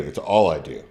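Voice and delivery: ordinary talk, nothing marked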